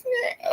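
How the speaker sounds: high squeaky fake sob on one syllable; mock whiny cry. Fed up with spam calls, a squeaky mock cry. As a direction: whimper it like a big baby.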